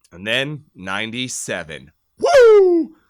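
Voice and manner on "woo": high, loud cheering whoop; a fan whooping over the Stanley Cup win; victory whoop. Retold or imitated. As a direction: let out a big celebration cry. Celebrating the 1997 Cup win.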